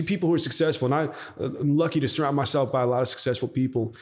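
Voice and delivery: ordinary delivery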